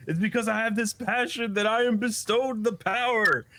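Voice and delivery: high heroic anime speech, building; impassioned declaration. Retold or imitated. Quinta praising passion as he powers up, impassioned.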